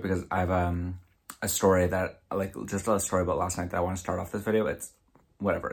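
ordinary talk

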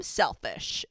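low, voiceless punchline word; imitating a TV hero's hushed admission; Damon. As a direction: drop low for a dramatic one-word confession. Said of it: "Damon's all like".

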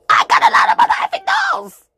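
sudden high, shouted panic; panicked shout. Acted. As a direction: scream it like the dolls are coming. Panicked comic outburst.

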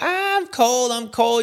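put-on high whining little kid voice; little Johnny whining he's cold; whiny kid. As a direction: whimper like a cold child.

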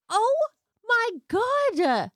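slow, high, drawn-out gasp of shock; stunned disbelief. Real, not acted. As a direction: let the shock stretch it out. Shocked reaction to torched seats.

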